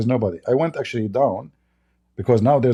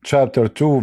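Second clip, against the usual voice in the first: normal talking voice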